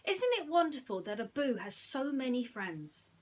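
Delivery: loud, beaming question; Aladdin, delighted. Acted. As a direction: gush about it. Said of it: "said Aladdin".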